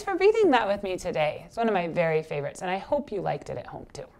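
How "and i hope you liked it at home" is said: ordinary delivery, nothing marked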